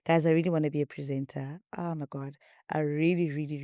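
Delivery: ordinary delivery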